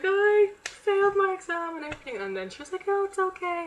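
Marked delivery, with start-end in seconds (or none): 0.0-2.2 high, loud mock wail; tearful wail. Retold tearful confession to teacher. 2.9-3.6 high, loud imitation of the teacher; comforting teacher. Teacher voiced comforting her.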